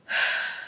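big, forceful exhale; loud sigh. Real, not acted. Vlogger pauses while urging viewers to be themselves, loud sigh. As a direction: let out a heavy breath.

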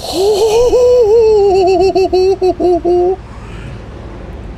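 high wail breaking into pulses; long, high cry that stutters out; wailing laugh. Real, not acted. Player insisting they're fine, wailing high laugh. Nervous moment in the game.

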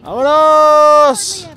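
high, loud, very slow stretched yell; a desperate leap, yelled long; long drawn-out shout. Real, not acted. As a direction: hold the cry the whole jump. Last jump, same call drawn out.

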